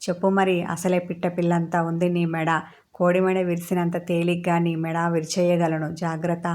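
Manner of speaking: ordinary delivery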